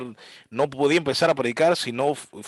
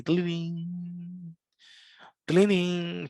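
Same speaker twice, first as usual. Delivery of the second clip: high sung tinkle of piano keys; piano tinkle imitation; 5 semitones higher, 4 dB quieter, much slower than usual. Preacher imitating piano plinks, high and light. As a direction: mimic a soft church piano.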